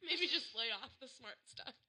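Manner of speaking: parents voice. Retold or imitated.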